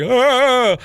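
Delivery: loud, high mock yell; adrenaline yell. Teller imitating the adrenaline rush, loud high yell. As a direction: let out an amped-up cry.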